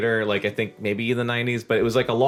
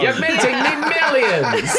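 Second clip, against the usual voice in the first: gushing agent voice; 12 semitones higher, 4 dB louder than usual. Pretend. Friends riffing agent pep talk.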